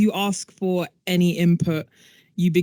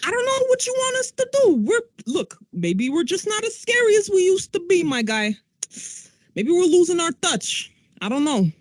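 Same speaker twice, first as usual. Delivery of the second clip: put-on high, strained demon accent, exasperated; a weary old demon whining; 9 semitones higher than usual. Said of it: "that is the voice of a demon".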